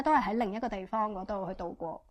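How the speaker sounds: ordinary delivery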